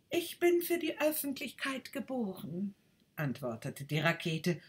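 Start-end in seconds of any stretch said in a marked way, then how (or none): 0.1-2.8 higher, lightly voiced boastful rocket; proud rocket. Rocket boasts to the duck, lightly voiced and higher. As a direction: puff yourself up a little.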